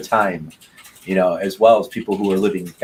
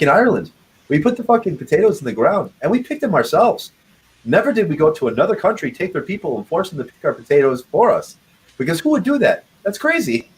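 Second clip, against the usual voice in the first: mock Irish comedian; 8 semitones higher than usual. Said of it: "He's like".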